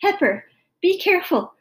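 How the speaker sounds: high, urgent cry of warning; alarmed warning. A narrator's reading. Mother warning daughter sweeping pepper about, alarmed cry. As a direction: call out in alarm.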